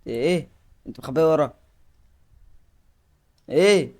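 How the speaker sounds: loud, high panicked yelling; startled yell. Real, not acted. Gamer yelling at the game monster, startled and loud. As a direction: yell it out in a sudden fright.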